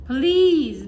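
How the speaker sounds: begging. A narrator's reading. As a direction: beg the wizard with all you have. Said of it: "begged Mister Crumble".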